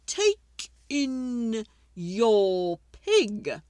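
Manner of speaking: put-on slow, measured old man's advice; slow wise old man. Wise old man advising the lady, slow and measured. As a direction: draw it out like a sage.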